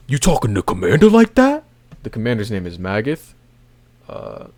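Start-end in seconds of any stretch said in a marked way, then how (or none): 0.1-1.6 higher, louder indignant retort; indignant mock challenge. Soldier scolding Colt for his tone, loud mock indignation. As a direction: call him out like a rude soldier. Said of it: "we got an ignorant person over here saying".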